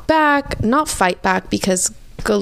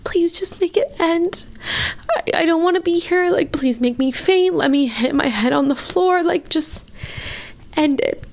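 desperate plea; 8 semitones higher than usual. Retold or imitated. Retelling own desperate plea.

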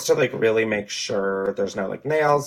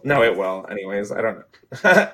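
usual speaking voice

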